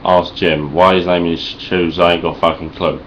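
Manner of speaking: ordinary talk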